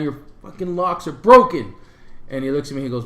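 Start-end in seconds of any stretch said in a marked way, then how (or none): 0.0-1.7 louder, fed-up snap at the desk clerk; frustrated outburst. Retold or imitated. Tired guest swearing at the clerk over broken locks, angry. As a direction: let the frustration spill out on the last line. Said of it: "Okay, look, man, I'm frustrated".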